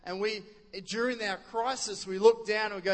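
ordinary talk

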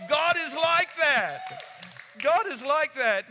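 loud, high proclamation; preaching shout. Real, not acted. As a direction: proclaim it to the whole hall. Preacher proclaiming God's friendliness.

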